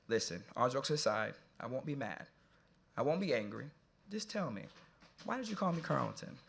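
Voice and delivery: serious and hushed. Retold or imitated. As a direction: drop the jokes and ask gently but firmly.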